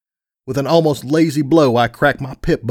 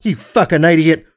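louder, higher angry insult; whip-like rebuke; 5 semitones higher, 5 dB louder than usual. A narrator's reading. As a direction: lash out angrily at a sibling. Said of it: "his voice like a whip".

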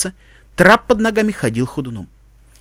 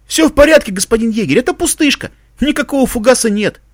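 high, eager subordinate voice; reporting soldier; 7 semitones higher, 3 dB louder than usual. Acted. Soldier tells commander the tripwire is a dummy, high-voiced report. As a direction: report up to your officer in a raised pitch.

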